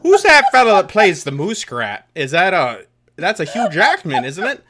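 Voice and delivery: loud, high, hammed-up befuddlement; mock clueless fan. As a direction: imitate a muddled fan through laughter. Mimics forgetful fan naming the muskrat.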